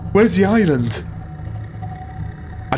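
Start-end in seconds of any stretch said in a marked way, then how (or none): 0.1-1.0 higher, louder worried repeat; rising panic as the island vanishes; anxious second ask, more urgent; panicky. Real, not acted. Lost in deep water, panicking.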